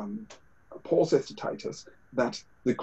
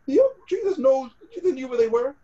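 crying; 10 semitones higher, 4 dB louder than usual. Real, not acted. Preacher's voice breaks with emotion.

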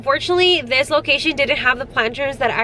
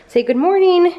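cheerful prompt; 5 semitones higher, 5 dB louder than usual. Real, not acted. Prompting toddler to greet.

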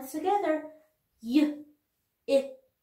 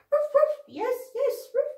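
much higher, excited little dog; puppet dog voice; 11 semitones higher, 4 dB louder than usual. Pretend. Teacher voicing the puppet Jump Up answering her, high and eager. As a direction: yip happily as the puppet. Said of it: "Jump Up".

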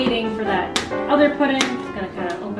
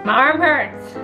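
louder, higher-pitched whine; whiny complaint; 5 semitones higher, 4 dB louder, slower than usual. Real, not acted. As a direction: complain about an aching arm. Complaining while mixing by hand.

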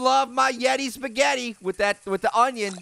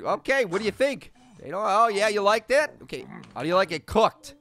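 usual speaking voice

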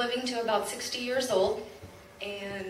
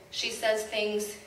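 ordinary talk

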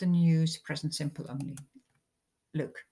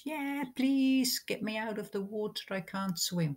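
put-on boy shouting; 5 semitones higher than usual. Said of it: "So this boy shouts".

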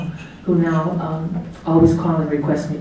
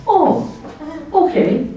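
high surprised oh, okay; a startled, amused oh then okay; 11 semitones higher, much slower than usual. Retold or imitated. Teacher seeing tushes out the window, surprised.

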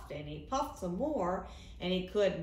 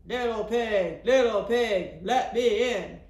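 loud, booming wolf voice; big bad wolf; 2 semitones higher, 10 dB louder, slower than usual. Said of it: "he said".

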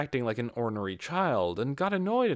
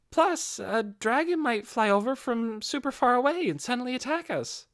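put-on much higher, playful young voice; tossed-out tease; 13 semitones higher than usual. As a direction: lob a silly idea to lighten the mood. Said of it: "Ninya tossed out".

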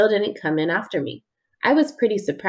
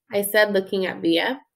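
ordinary delivery, nothing marked

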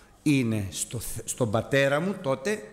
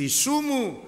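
high, fervent cry; believer's fervent cry to Jesus, high; calling out to Jesus; 10 semitones higher, slower than usual. Pretend. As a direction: call on Jesus with faith.

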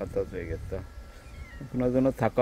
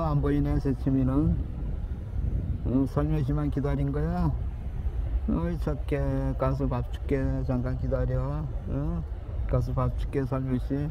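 cooing pet talk; 5 semitones higher than usual. Real, not acted. Greeting a waiting stray cat, higher cooing pet talk. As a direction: coo to the shy cat.

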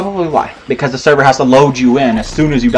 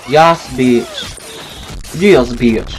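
silly character voice; 2 semitones lower, much slower than usual. Acted. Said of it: "I should have done that voice".

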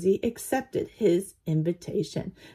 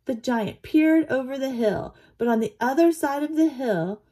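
plain reading voice

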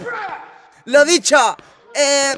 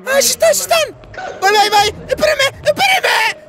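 high, frantic cries; panicked shouting; 10 semitones higher than usual. Real, not acted. Gamer calling after the others, high panicked shouting. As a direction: cry out urgently to be waited for. Players leaving him behind.

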